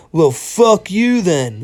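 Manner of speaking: hammed-up pouty defiance; mock sore loser. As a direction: drag it out like a sulky loser. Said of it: "stand up and go".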